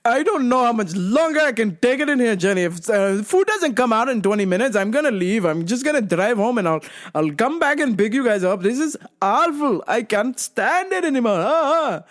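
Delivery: high, sing-song griping; exaggerated exasperation in dad's voice; fed-up dad. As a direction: mimic dad whining his way to an outburst. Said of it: "my dad's like".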